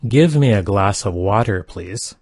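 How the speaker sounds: ordinary delivery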